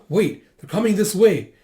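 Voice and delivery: sudden urgent realization; alarmed. Acted. Villager sees the soldiers heading over, alarmed. Character spots soldiers coming.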